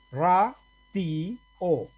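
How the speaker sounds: "Ra, ti, o" in three separate syllables. Shown as a demonstration. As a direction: break it up as "Ra", "ti", "o", pausing between. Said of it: "aquí hay ¿cuántas sílabas?".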